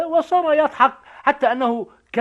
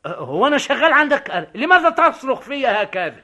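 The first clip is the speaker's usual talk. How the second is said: indignant, higher-pitched retort; raised voice; 5 semitones higher, 4 dB louder than usual. Acted. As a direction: protest at being shouted at. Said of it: "ترفع صوتك في حضرتي؟". (محتجًّا بصوت مرتفع)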